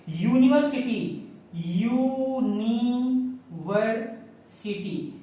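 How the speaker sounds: यूनिवर्सिटी drawn out very slowly, yoo-ni-ver-si-ty; careful pronunciation. Shown as a demonstration. Teacher slowly models यूनिवर्सिटी for students. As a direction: spell out यूनिवर्सिटी syllable by syllable, starting with a clear y sound. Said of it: "इसका sound होगा ऐसे".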